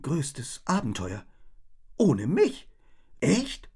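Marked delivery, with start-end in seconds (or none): 2.0-3.7 ohne mich rising, hurt surprise on mich; stung ohne mich, voice going up; disbelieving question. A narrator's reading.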